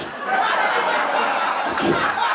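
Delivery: loud, high laughter breaking through the words; cracking up at the absurdity; laughing in disbelief. Real, not acted. Comedian laughing in disbelief at the statistic. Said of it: "That was the statistic!".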